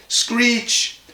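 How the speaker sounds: high, drawn-out creak of an unoiled wheel; squeaky wheel screech. A narrator's reading. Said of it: "it went screech".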